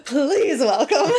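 high, slow, hammed-up introduction; reluctant announcer. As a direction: drag out the announcement, half laughing. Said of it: "looks like he does not wanna be there".

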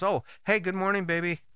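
lightly put-on lovey good-morning; sweet morning greeting. Acted partner greeting in the morning.

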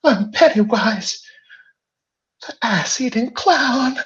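very high, loud evil clown voice; Pennywise. Acted. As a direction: shriek it like a monstrous clown. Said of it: "those famous words".

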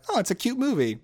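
high, cutesy little reaction; cooing approval. Acted. Reviewer reacting to an unusually sweet western, cooing. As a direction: coo it lightly. Acts a gushing reaction to the film.

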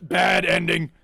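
ordinary talk

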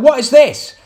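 high, loud heckler shout; mock heckler shout. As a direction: yell it like a baffled punter. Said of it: "Someone shouting".

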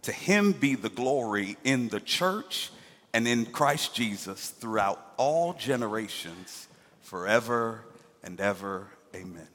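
soft, low closing blessing; prayerful benediction. Acted. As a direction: bless the church quietly, reverently.

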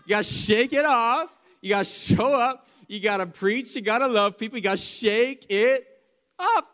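loud, high, driving exhortation; rousing preacher's shout. Real, not acted. Preacher urging the church to keep going, rousing shout. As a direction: build a chant of urgings, pitched high.

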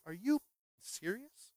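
as if the order is absurd; incredulous. Acted. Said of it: "And Naaman's like".

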